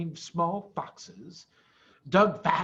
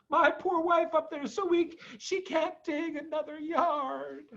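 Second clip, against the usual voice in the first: sad Badger; 11 semitones higher than usual. A narrator's reading. Badger speaking of his weak wife, sad.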